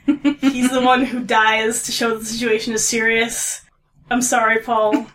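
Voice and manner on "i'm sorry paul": normal talking voice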